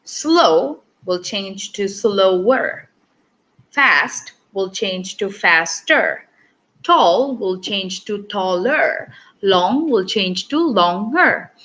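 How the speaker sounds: each word laid out slowly for the class; patient, drawn-out teaching read; slow demonstration. Shown as a demonstration. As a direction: spell it out slowly, teacher style. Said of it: "let's take a look".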